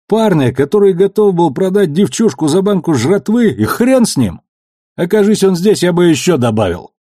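angry outburst in defence. Acted. Amos furious about Ganymede.

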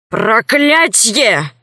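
drawn-out, shouted curse; angry curse. Acted. Old man of the mountain overhears his betrayal, shouted curse. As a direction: spit out a long, furious oath.

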